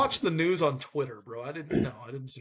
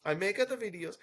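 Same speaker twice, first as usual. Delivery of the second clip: lightly mocked Italian accent, higher and softer; 4 semitones higher, 5 dB quieter than usual. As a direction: echo it in a joking Italian accent. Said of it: "after I put it in the Italian accent".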